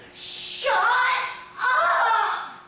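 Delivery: loud, high shout; the girl yelling at her crying doll again; screaming girl. Retold or imitated.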